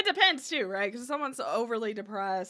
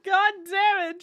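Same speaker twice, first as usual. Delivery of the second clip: whiny fake cry; mock crying; 8 semitones higher, 7 dB louder than usual. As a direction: whimper the curse out. Mock cry at game attack.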